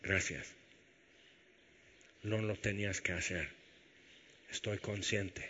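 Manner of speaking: soft, low, tender thank-you; quiet thanks. Acted. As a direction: say it gently, looking up at Him. Voiced prayer of thanks to Jesus.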